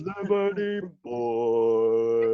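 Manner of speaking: slow stretched stage shout; hyped crowd warm up. Pretend. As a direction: drag the band name out. Band announcing itself on stage.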